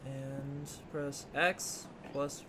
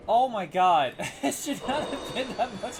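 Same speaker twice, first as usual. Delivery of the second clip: much louder "oh my God"; loud exasperated outburst; 5 semitones higher, 7 dB louder than usual. Real, not acted. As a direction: burst out in relief. Relieved after a long struggle.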